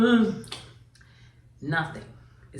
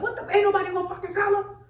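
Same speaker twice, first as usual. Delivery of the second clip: much higher, fast exasperated call-out; 14 semitones higher, much faster than usual. Retold or imitated. A woman in the crowd calling out, fast high exasperation. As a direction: blurt it like a fed-up auntie in the crowd.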